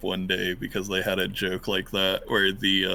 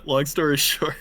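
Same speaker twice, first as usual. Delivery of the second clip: normal talking voice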